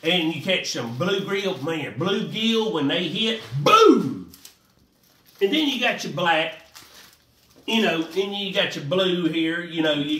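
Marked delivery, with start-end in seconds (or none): 3.6-4.3 loud punchy boom; sudden loud exclamation; excited boom. Real, not acted. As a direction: slam the word like a fish hitting. Fish strike exclamation.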